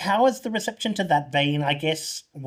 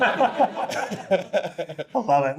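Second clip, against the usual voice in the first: laughing; 3 semitones higher, much slower than usual. Real, not acted. Host reacts laughing to the story.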